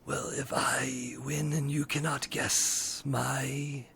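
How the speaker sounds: slow eerie whisper of a riddler; whispering sphinx. Acted. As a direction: breathe the riddle out slowly, menacing. DM voices masked skeleton.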